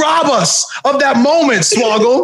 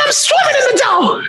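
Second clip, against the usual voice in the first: high, committed Jersey heel shout; hammed-up Jersey braggart; big jerk from Jersey; 12 semitones higher than usual. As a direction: crow it, pitched way up. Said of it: "this big jerk from Jersey".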